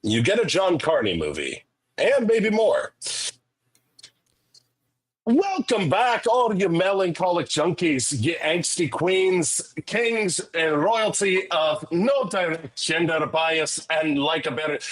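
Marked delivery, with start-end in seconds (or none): 5.2-14.8 stretched-out greeting in a put-on Scottish accent. Shown as a demonstration. Host welcoming the podcast's melancholic junkies, in a put-on Scottish accent. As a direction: attempt an Irish accent that comes out Scottish. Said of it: "I clearly have decided to do Scottish instead".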